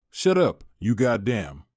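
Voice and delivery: usual speaking voice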